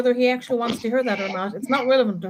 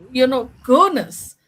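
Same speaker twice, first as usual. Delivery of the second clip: usual speaking voice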